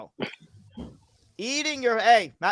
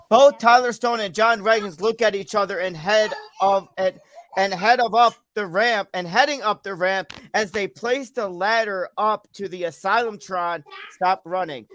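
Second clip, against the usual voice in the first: match commentary building to an excited call; wrestling play-by-play. Acted. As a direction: build up to the big leap off the ladder. Calls an imaginary wrestling match.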